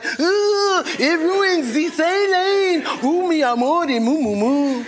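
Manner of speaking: slow, hammed-up bad French accent. Mocking French people who hated the Eiffel Tower, bad French accent. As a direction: ham up a terrible French accent. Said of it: "the worst impression of a French person".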